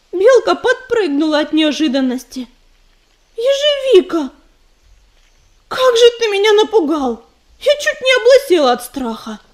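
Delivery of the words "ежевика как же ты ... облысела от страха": high, loud startled outburst; startled babble. Acted. Belka is caught off guard by Ezhevika, a startled high cry. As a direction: jump at the sudden voice and blurt it out. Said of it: "Пролепетала она".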